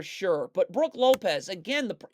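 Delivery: ordinary delivery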